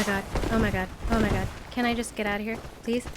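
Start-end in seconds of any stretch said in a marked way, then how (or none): none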